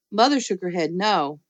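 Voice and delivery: ordinary delivery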